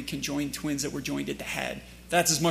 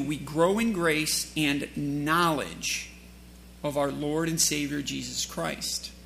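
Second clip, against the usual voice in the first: whole phrase slow and weighted; slow emphasis; slower than usual. Shown as a demonstration. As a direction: lay out each word slowly. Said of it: "You'll notice I emphasize the word knowledge".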